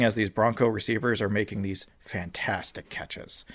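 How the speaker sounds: dismayed whisper. Real, not acted. Dismay at Bronco catches.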